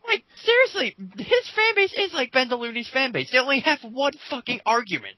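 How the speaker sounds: fed up and ranting about the fans; exasperated rant. Real, not acted. Ranting about fan base.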